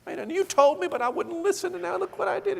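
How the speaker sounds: high-pitched imitation of a weeping wife; mock crying. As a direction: wail the apology in a put-on tearful voice. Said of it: "she's crying, and she says".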